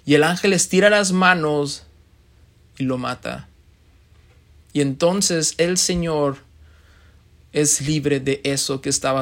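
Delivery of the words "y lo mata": ordinary talk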